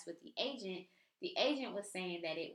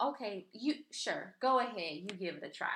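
sarcastic go ahead. Retold or imitated. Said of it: "in my mind".

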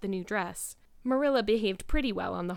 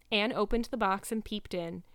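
plain reading voice

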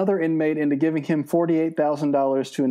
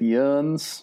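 Tennessee accent. Shown as a demonstration. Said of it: "is replaced with".

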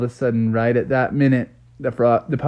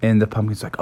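usual speaking voice

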